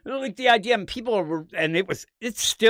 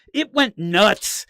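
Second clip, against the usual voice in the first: ordinary talk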